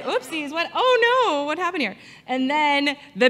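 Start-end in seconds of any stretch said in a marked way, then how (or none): 0.0-2.0 mock surprise.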